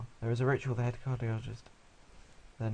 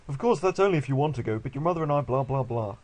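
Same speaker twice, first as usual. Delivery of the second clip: louder, pitched-up dad voice; mock father voice; 3 semitones higher, 6 dB louder than usual. Father to son about the choice of school, loud mock voice. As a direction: read the parent loudly, tossing off the blahs. Father's dialogue, read mockingly.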